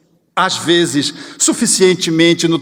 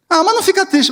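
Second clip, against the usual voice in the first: put-on high little child voice, coaxing; child consoling; 10 semitones higher, faster than usual. Child comforts the crying mother, high and coaxing. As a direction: play the child begging mom not to be sad.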